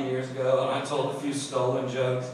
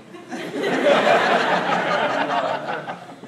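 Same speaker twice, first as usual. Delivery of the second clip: breathy laugh; 5 semitones higher, 5 dB louder than usual. Real, not acted. Laughing at own story.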